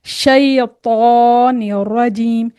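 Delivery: शैतानिर रजीम recited very slowly, with a heavy ta; slow recitation demo. Shown as a demonstration. Teacher reciting slowly to show the heavy letter. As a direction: draw out शैतानिर रजीम and stress each sound. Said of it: "जैसे मैंने ये पढ़ा था ना".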